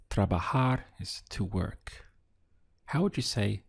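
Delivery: ordinary talk, nothing marked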